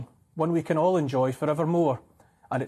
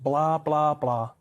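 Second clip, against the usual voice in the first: monotone imitation of tedious speeches; mock droning; much slower than usual. Mocking the Downing Street speakers, droning. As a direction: drone it on one note. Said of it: "droning on and on".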